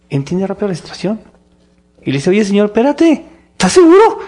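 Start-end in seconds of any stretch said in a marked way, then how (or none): 2.3-4.2 shocked, can't believe the order; incredulous. Retold or imitated. Ananías questioning the Lord, loud and disbelieving. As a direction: voice leaps higher and louder on "seguro". Ananías doubting God, acted.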